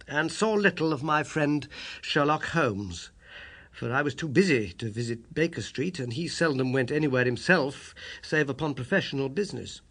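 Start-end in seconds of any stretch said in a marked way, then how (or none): none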